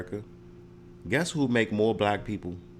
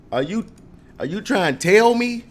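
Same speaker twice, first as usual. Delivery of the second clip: higher and louder, leaning on tell; indignant shout; 8 semitones higher, 7 dB louder than usual. Acted. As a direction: call the clerk out loud. Acting out confronting clerk.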